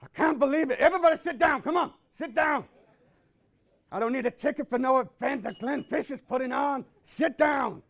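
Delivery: loud ranting at the crowd; hammed-up loud rant; angry shouting. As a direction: bark orders at the room.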